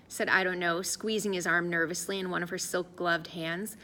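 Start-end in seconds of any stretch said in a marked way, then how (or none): none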